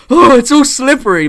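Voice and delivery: high, loud, hammed-up horror; mock horrified. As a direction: act shocked and horrified, voice jumping up high and loud. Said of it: "look horrified and be like".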